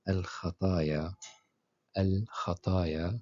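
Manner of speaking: Al-khataya spelled out slowly, syllable by syllable; نطق بطيء لكلمة الخطايا; slow model word. Shown as a demonstration. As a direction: drag out Al-khataya slowly for learners. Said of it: "Kha au milieu d'un mot".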